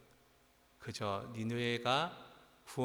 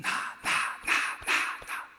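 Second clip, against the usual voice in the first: the same short word punched out loudly over and over; hammered repetition; 7 dB louder, much slower than usual. Shown as a demonstration. Preacher counting Jonah's repeated "I"s, hammered repetition. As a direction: hit each "나" as a separate hard beat.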